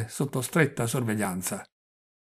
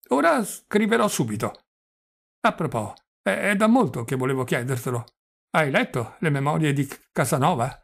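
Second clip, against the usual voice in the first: put-on doddering old prince; 3 semitones higher, 4 dB louder than usual. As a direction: play the flustered old prince, pitched up. Old prince voiced in reading.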